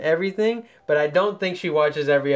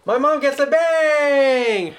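slow, much higher triumphant call; excited announcement; 8 semitones higher, 4 dB louder, much slower than usual. Acted. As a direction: announce it like a big win. Host announces prize result.

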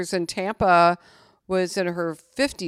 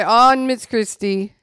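slow, loud Belizean imitation; loud mock Belizean nanny; Belizean accent; 4 semitones higher, 10 dB louder, slower than usual. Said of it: "She would say".

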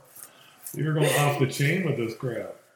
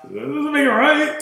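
mocking retort; 12 semitones higher, 3 dB louder, slower than usual. Mock retort to partner.

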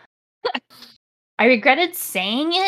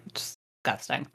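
disgusted; 8 semitones lower, 13 dB quieter, faster than usual. Real, not acted. Disgusted aside.

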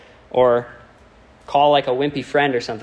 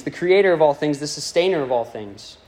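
ordinary talk, nothing marked

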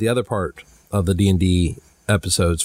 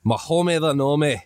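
higher-pitched Scottish gnome voice; Mahomey the Nome; 7 semitones higher than usual. Shown as a demonstration. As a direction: play a little Scottish gnome. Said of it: "He's a Scottish little deep gnome".

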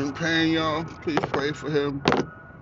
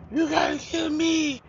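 Shaft movie line. Retold or imitated. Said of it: "I remember that from people from Shaft".

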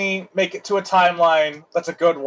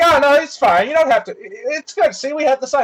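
cheerful dismissal; 7 semitones higher than usual. Acted. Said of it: "And they're like".

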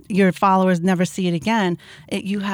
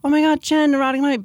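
high, loud fan squeal of recognition; a fan spotting her in the street, high and thrilled; excited fan; 7 semitones higher, 4 dB louder, faster than usual. Retold or imitated. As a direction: gush like a starstruck fan. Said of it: "they're like".

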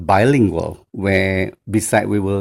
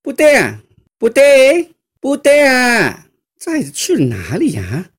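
stepmother calling in Mandarin; 13 semitones higher, 5 dB louder than usual. Retold or imitated. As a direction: call out the name, then grumble. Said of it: "if I tell in Mandarin".

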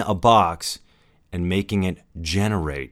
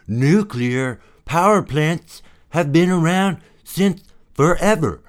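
raised, slowed mock objection; skeptical viewer voice; 8 semitones higher, 4 dB louder than usual. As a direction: play the doubting viewer, pitched up. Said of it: "a lot of you might be saying".

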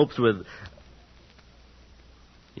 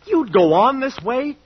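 appalled accusation; horrified outburst; 8 semitones higher, 3 dB louder than usual. Acted. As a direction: hurl it at her in disbelief. Lover realizes he is doomed.

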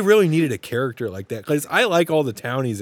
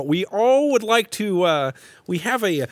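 higher, slow, halting official; Dosi; 8 semitones higher, 4 dB louder than usual. Pretend. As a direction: address a town meeting with pompous hesitation. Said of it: "Yeah, Dosi's like".

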